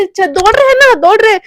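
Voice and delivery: jolted by the shot, promising to run; startled yelp. Acted. Gunshot forces them to run.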